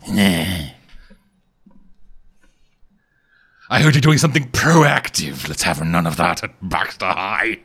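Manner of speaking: slow disapproving principal; mock stern authority. Ghost principal scolding a student, low slow stern.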